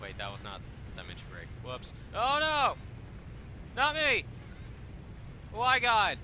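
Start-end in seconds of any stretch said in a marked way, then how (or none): 2.2-6.2 high, loud melodramatic cries; mock despair shouts. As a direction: wail it out in hammed-up despair. Crying out in gameplay.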